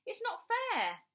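high, whiny tantrum cry; shouted protest. A narrator's reading. Bradley to his mother, shouting in protest. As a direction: protest like a child stamping a foot. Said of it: "he shouted, stamping his foot".

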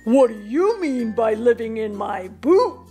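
giant's indignant retort; mild shout in a giant's voice; shouting back. A narrator's reading. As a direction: throw the question back as an angry giant. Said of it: "Joe shouted back".

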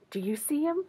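soft, gently rising question. Real, not acted. Reader asks children about stranger, soft question. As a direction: ask the little listeners quietly.

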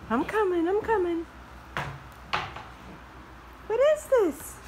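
squeaky high voice for the puppy. Real, not acted. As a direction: coo at the dog in a high sing-song. Cooing at dog over toy.

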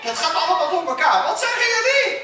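loud, high-pitched shouting over the hubbub; schreeuwen. Acted. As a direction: shout out, flustered, to be heard. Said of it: "je hoeft niet zo te schreeuwen". (luid en hoog schreeuwen)

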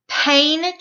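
usual speaking voice

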